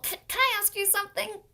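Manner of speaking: high, fast stammered question; nervous ask. A narrator's reading. Paul nervously works up to asking Leslie. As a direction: stumble over it nervously.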